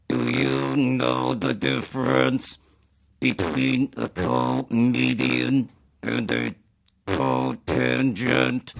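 very slow synthesized speech; flat, slow computer voice; Stephen Hawking voice. Retold or imitated. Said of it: "his comedy, it would be like".

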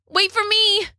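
high-pitched call after a friend; acted alarmed plea; anxious call. A narrator's reading. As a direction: call out not to be left behind, pitch way up. Rob calling after escaping Bella.

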